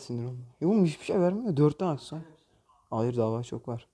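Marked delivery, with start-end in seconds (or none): 0.6-2.2 higher, louder exasperated complaint; annoyed gamer yelling at the game; frustrated outburst. Real, not acted. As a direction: rant at the game for cheating you. Angry at bad box reward.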